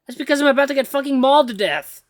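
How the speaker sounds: high, loud furious retort; panicked snap. Acted. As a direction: snap back in terror. Snapping at the AI.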